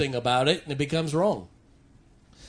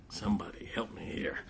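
whisper; 8 semitones lower, 15 dB quieter than usual. Real, not acted. Preacher pleads to congregation.

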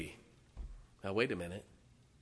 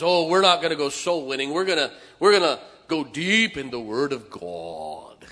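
mocking pious voice; 3 semitones higher, 12 dB louder than usual. Mocking deeper lifers.

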